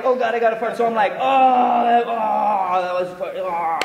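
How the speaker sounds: long, loud moaning, high then sinking; hammed-up moans of faked pleasure; faked orgasm moan.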